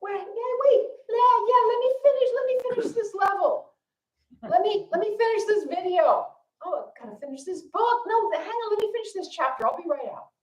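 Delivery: put-on a kid pleading to finish a level first; stalling kids. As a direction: kids ignoring the fire to finish a game. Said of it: "And the kids say".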